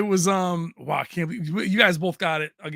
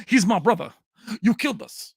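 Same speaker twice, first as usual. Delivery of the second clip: hammed-up Spanish-Texas accent; over-the-top Pacino accent; 5 semitones higher, 5 dB louder than usual. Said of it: "he was a little over the top".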